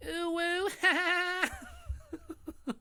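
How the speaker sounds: very high squealy hoots and giggles; mock squeal like an excited kid; squealing laugh impression. Shown as a demonstration. Imitating Kiwi for chat with squealing hoots. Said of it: "That's my Kiwi impression".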